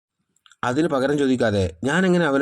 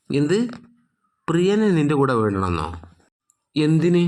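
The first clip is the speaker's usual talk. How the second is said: angry outburst; 6 semitones higher than usual. A narrator's reading. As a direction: snap back in disbelief, going higher. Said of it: "മേഘ ദേഷ്യം കൊണ്ട്". (ദേഷ്യത്തോടെ ഉയർന്ന ശബ്ദത്തിൽ)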